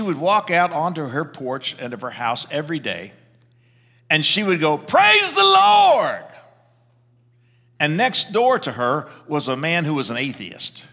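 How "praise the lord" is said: high, slow, drawn-out shout of praise; joyful shout. Retold or imitated. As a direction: belt it out from the porch like an old church lady. Elderly woman's daily porch cry.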